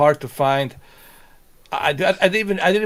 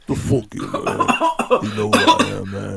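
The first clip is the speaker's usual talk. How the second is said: growling voice; 6 semitones lower than usual. Retold or imitated. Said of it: "stop with the growling voice".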